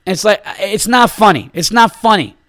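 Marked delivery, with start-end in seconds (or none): none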